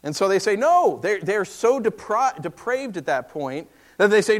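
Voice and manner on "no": mob's shout. Retold or imitated. The mob refusing Lot.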